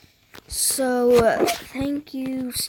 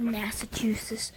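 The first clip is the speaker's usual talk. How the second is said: whispered; 2 semitones lower, 7 dB quieter than usual. Acted. Whispering the name at Massachusetts.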